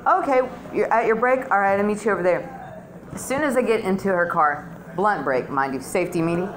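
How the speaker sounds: usual speaking voice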